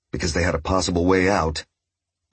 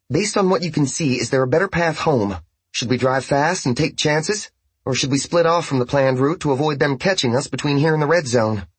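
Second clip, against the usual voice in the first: higher, lighter woman's voice; female character voice; 7 semitones higher than usual. A narrator's reading. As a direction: voice Bobby higher and calm.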